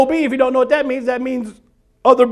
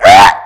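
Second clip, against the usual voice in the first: mock shriek; 12 dB louder than usual. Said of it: "it went".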